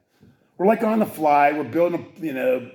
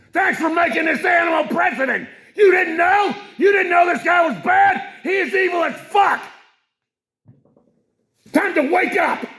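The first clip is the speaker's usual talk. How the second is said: ranting shout; 13 semitones higher than usual. Real, not acted. Host yells at preachers, gun owners and podcasters, furious shout. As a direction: lose your temper and scream it at them. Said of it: "I'm sorry again for losing my temper".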